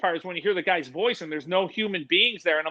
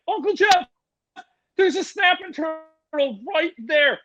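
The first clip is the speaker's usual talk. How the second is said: put-on much higher, slower kid calling out; excited kid nephew; 9 semitones higher, slower than usual. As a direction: mimic a kid spotting a turtle, high. Nephew calling out about turtle.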